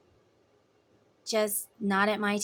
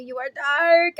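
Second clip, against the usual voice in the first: loud, raised cry of disgust; mock revulsion; 5 semitones higher, 9 dB louder than usual. Your energy pushing someone away, loud mock revulsion. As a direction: push it louder and higher, overdone.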